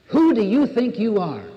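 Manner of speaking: life's challenge. Retold or imitated. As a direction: throw the question down like a dare. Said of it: "life says to us".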